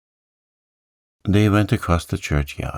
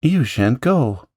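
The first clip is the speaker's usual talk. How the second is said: higher, slow and pleading; anxious wife; 7 semitones higher, 4 dB louder than usual. Acted. Wife begging the vicar not to go, higher and pleading. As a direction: hold him back with your voice.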